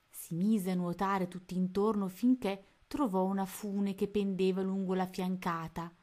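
usual speaking voice

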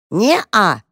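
mild shouted reply; cried out. A narrator's reading. Mice answer their mother over bells, calling out. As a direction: chirp it out as a quick cry. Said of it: "крикнули мышата".

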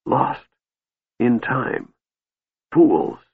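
single words gasped out between pauses. A narrator's reading. Dying mage cursing his failure, gasping. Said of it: "Raistlin gasped".

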